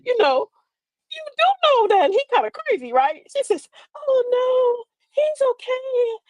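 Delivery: girlish high-pitched imitation; high, sweet mock girlfriend voice; high girly voice. Mother-in-law quotes the girl.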